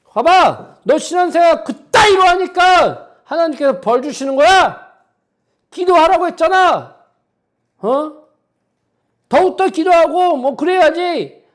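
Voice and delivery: high, loud mock lecture of a judgmental churchgoer; scolding rebuke. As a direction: scold the struggling like a self-righteous elder. Mocking a judgmental believer. (훈계하는 교인을 흉내 낸 높고 큰 꾸짖음)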